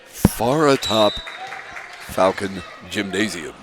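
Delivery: slow, booming gym announcer. Pretend. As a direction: announce it like the arena PA. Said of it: "that's my bee's voice".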